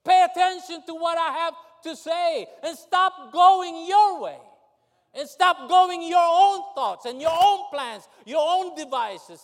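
loud, high preacher's cry; impassioned sermon delivery; preaching at full force. Acted. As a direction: preach it out with fire.

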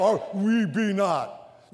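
low, slow pouting "we be not"; whiny. Shown as a demonstration. Preacher offering the doubtful choice, slow. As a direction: whine the doubt. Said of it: "are we able?".

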